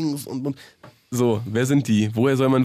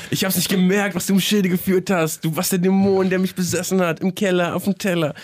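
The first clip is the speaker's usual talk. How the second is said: mock diss lyrics; 4 semitones higher than usual. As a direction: parody the lyrics like a dramatic rapper. Parodiert Arafats Track-Zeilen. (spöttisch vorgetragene Disszeilen, höher gesprochen)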